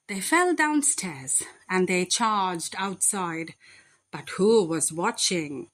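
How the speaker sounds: plain reading voice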